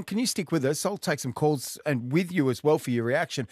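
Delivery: ordinary talk, nothing marked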